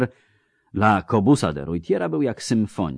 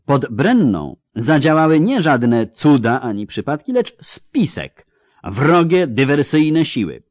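higher-pitched, emphatic reprimand; stern military examiner; 5 semitones higher, 4 dB louder than usual. Acted. Captain correcting a cadet before the class, stern reprimand. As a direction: lecture the cadet with official indignation.

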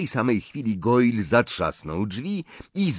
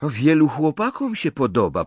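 put-on higher, louder girl's voice; Pansy; 7 semitones higher, 5 dB louder than usual. A narrator's reading. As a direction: put on a pert girl's voice, pitched up. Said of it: "powiedziała Pansy".

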